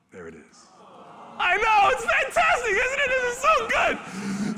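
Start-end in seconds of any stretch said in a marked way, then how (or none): 1.4-4.6 very high, loud joyful cry; overjoyed. Real, not acted. Keeper thrilled at the newborn cub, overjoyed. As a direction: burst with delight. Baby tiger is born.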